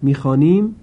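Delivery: ordinary delivery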